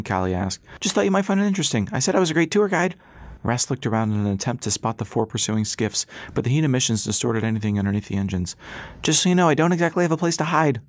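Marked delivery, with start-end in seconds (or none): 0.8-3.0 high, fast, chatty pilot voice; breezy pilot character. A narrator's reading. Dixie to passengers during the chase, breezy high voice. 9.0-10.8 high, raised pilot's voice; shouted. A narrator's reading. Dixie to passengers with police behind, shouted. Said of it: "she shouted".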